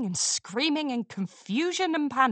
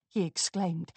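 plain reading voice

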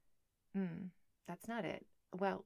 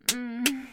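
long loud moan; 5 semitones higher, 13 dB louder than usual. Acted.

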